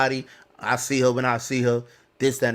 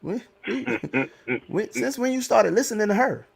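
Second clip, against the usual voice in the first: higher, laughing scolding as mom; mimicking his mom; 5 semitones higher, 4 dB quieter than usual. His mother scolding him for listening to the mother, laughing and higher. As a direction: tease him like a mom who can't believe it, chuckling. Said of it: "My mom's like".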